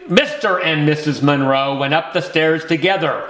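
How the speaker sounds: ordinary delivery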